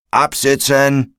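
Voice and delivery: barked hoarse command. A narrator's reading. Fireman to crew on arrival, drawn-out barked order. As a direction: bark it out like a fire chief, mildly. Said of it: "bellte eine heisere Männerstimme".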